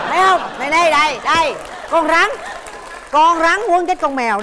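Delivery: triumphant insistence. Real, not acted. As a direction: crow it like proof of victory. Host triumphantly proves snake beats cat.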